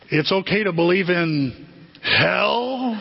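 slow question, rising on the last word; curious boy questioning. Retold or imitated. Brother asking the nun about hell, slow sly question. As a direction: imitate a boy testing a forbidden word. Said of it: "and Danny goes".

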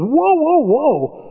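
high mock whoa; hammed-up startled whoa; startled Peter. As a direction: pull up short in alarm. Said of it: "Peter goes".

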